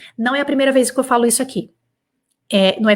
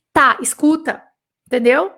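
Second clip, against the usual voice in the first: usual speaking voice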